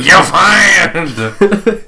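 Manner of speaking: high, loud mock firing; a playful put-on chief yelling at his cop; mock police chief. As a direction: bellow it like a hammed-up TV police chief. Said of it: "the chief comes in".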